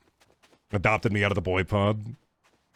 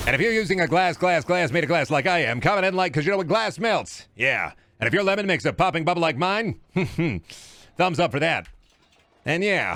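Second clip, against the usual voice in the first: put-on higher-pitched old-timey presenter; bouncy sing-song broadcast patter; radio host parody; 4 semitones higher than usual. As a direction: rattle it off like a vintage announcer.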